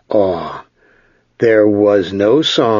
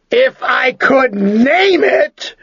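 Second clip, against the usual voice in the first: shouting; much slower than usual. Acted. Ged cries out.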